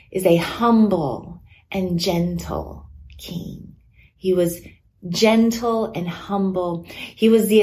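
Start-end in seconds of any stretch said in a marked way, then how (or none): 3.2-3.7 whisper. Real, not acted. Hushed word ending a point.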